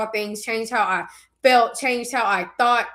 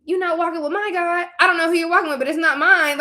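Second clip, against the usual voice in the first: higher-pitched, indignant clapback; mock confrontation, pitched up; indignant retort; 6 semitones higher, 3 dB louder than usual. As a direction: set them straight, raised pitch. Retorting to false believers.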